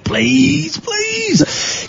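high, cracking pleading cry; mock wailing plea. Host begs his co-host to stop, mock wailing. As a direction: whine it like a begging child.

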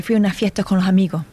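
Spanish with dropped S sounds; Andalusian accent. Shown as a demonstration. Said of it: "a precise example of the Andalusian accent".

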